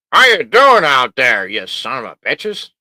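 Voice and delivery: a loud mock greeting that drops to a growl; hammed-up imitation of a podcast host greeting; imitating Jay. Streamer imitating the Sci-Fi Nation host's greeting. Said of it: "not trying to imitate, uh, Jay from".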